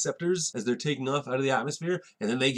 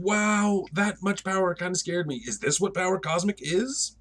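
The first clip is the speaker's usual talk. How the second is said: high-pitched mock fright; unsettled, pitched-up wonder; shaken awe; 7 semitones higher than usual. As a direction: marvel nervously at your own power. Johnny shaken by his power.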